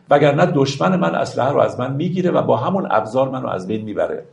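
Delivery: ordinary talk, nothing marked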